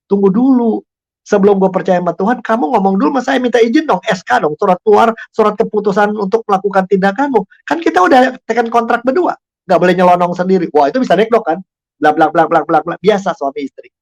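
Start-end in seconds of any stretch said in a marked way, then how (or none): 0.1-10.7 higher, insistent teasing protest; husband demanding his wife ask permission first, raised and insistent; mock indignant husband. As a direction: demand the paperwork like an offended official. Husband's retort in retold quarrel.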